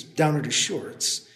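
ordinary delivery

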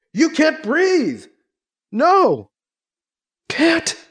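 high and strained; choking out words in fear; panicked gasping. Acted. Suffocating, panicked.

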